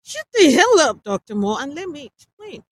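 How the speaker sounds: high, loud angry scolding. A narrator's reading. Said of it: "Dick scolded".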